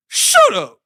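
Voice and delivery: a loud mock snap; imitating Debo's loud shut up. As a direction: bark it like a bully shutting her up. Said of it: "Debo was like".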